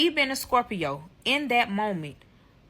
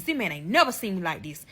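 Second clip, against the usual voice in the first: ordinary talk, nothing marked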